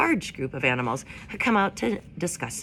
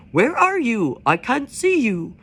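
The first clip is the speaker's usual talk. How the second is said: louder, higher, slow frog call; croaking frog; 5 semitones higher, 7 dB louder, slower than usual. A narrator's reading. A frog calling for its mate, louder and higher. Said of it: "One would croak".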